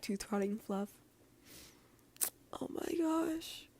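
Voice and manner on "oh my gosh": higher, slow, breathless whisper of delight; whispered squeal. Real, not acted. Reader gushing over the fluffy story, whispered and high. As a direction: gush over the sweetness in a hushed voice.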